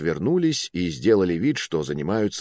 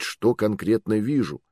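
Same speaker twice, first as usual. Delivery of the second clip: usual speaking voice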